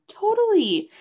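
an eager, upbeat yes; emphatic totally. Retold or imitated. Answering an amazed person eagerly.

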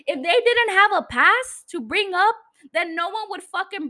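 heated rant voice; angry. Real, not acted. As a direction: hit it with real anger.

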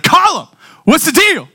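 high, loud demand; coach's yell. Retold or imitated. Coach confronting player on the sideline, loud yell.

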